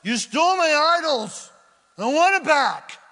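shouted high, furious; angry accusation. Acted. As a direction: yell it at the thief. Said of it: "Laban accusing Jacob".

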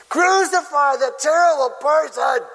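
high, loud theatrical condemnation; mock mob shout. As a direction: shout it like an outraged crowd. Acting the outraged crowd.

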